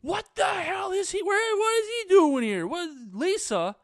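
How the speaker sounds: hammed-up furious yelling at a friend; freaking-out shout. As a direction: lose it completely, high and loud. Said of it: "she freaks out".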